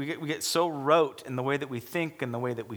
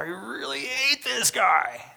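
higher, slow, whiny mock grudge; imitating bitter grudge; 8 semitones higher, 4 dB louder, much slower than usual. Pastor voicing the angry man's relapse, high slow mock grudge. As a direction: let the grudge slip out, drawn out.

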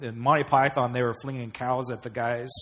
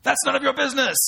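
much higher, mock French sneer; snooty French retort; 11 semitones higher, 4 dB louder, faster than usual. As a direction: toss it off like a taunting Frenchman. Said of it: "And the French reply".

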